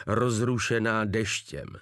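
usual speaking voice

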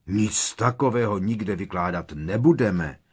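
loud, urgent protest; shouting out. A narrator's reading. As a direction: shout it out in denial. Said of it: "rozkřikl se Harry".

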